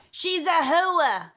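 put-on New York accent, drag out "hooah" slowly; imitating a New Yorker saying "hooah". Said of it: "People would say hooah".

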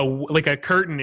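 ordinary delivery

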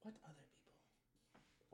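breathy whispered feigned innocence. Acted. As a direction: whisper it, playing dumb. Player feigns ignorance.

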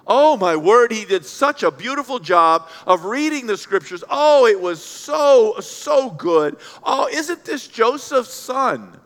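loud, drawn-out gushing praise; gushing admiration. Acted. Synagogue crowd marvels at the hometown reader, gushing. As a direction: gush like an overawed crowd, stretching the words.

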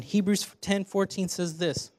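ordinary delivery

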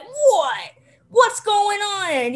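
put-on tough New York kid voice, drawn out slow; slow, gruff little mobster impression; mini Edward G. Robinson. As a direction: growl it like a pint-sized gangster. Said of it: "she drops her voice an octave".

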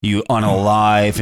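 ordinary talk

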